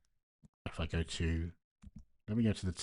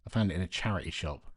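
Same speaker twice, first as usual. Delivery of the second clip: usual speaking voice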